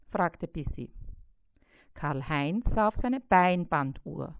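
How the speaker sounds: ordinary delivery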